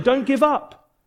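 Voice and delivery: high, ringing encouragement; preacher calling out hope to the wanderers; encouraging call. Acted. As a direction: cheer them on toward the finish. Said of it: "We'd say".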